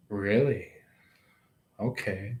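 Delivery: quiet hushed surprise; soft low surprise. Pretend. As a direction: let it land softly, a little stunned. Imagined reply to a confession.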